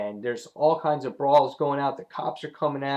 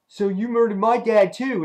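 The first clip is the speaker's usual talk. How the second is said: acted angry retort; 7 semitones higher, 4 dB louder than usual. Acting out the rival's retort.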